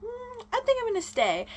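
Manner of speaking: high, airy mock girl voice; high, breezy imitation of a girl brushing someone off; mock Belly. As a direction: flutter it up high like a flighty girl. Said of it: "she's just like".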